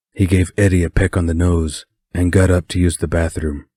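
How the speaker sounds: usual speaking voice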